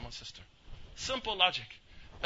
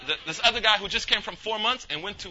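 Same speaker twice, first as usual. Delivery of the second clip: usual speaking voice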